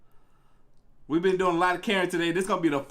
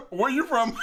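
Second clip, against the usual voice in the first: quoting the man high, through laughter; laughing quote; 7 semitones higher than usual. Retold or imitated. Streamer repeats the man's question to the Karen, laughing. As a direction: mimic him while laughing. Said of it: "He like".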